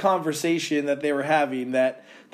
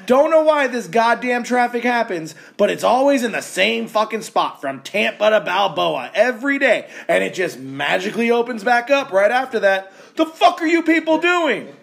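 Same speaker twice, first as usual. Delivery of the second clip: angry rant; 5 semitones higher than usual. Real, not acted. Host ranting to cohost about traffic, exasperated. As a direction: throw up your hands and vent.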